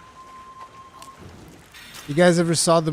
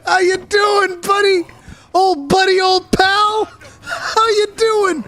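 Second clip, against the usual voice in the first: squeaky cartoon voice; 4 dB louder than usual. Greeting the sleeping hunter in a squeaky cartoon voice. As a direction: chirp at him like a cartoon pest. Silly voice trolling sleeping player.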